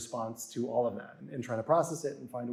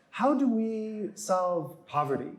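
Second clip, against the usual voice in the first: slow, higher-pitched earnest questioner; 7 semitones higher, slower than usual. Retold or imitated. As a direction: put a big question slowly, imitating an audience member. Audience member asks him earnestly.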